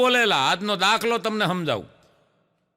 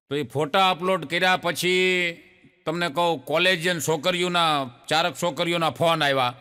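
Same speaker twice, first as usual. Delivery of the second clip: ordinary talk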